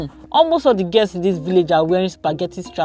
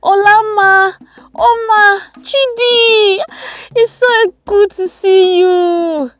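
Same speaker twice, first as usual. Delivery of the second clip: high, loud, drawn-out calls; excited greeting; 13 semitones higher, 6 dB louder, much slower than usual. A narrator's reading. Cousin rushing out to greet visiting cousins, excited high shout. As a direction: squeal the names in delight, stretching the last words. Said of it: "Chizoba rushed out".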